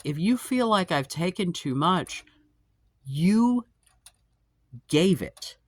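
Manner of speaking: indignant. Real, not acted. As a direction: spell out the unfairness.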